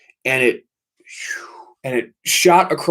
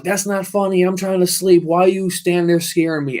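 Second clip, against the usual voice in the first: higher-pitched indignant complaint, retold; retold anger; 6 semitones higher than usual. Storming into brother's room to complain, retold anger. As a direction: replay an indignant telling-off, pitched up. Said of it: "I got so mad".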